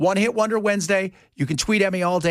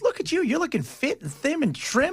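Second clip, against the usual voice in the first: Bell's fake compliment; 8 semitones higher, 3 dB quieter than usual. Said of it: "Bell turns around and goes".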